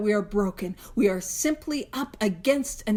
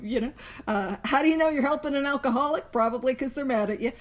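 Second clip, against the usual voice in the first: normal talking voice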